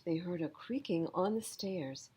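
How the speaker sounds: ordinary delivery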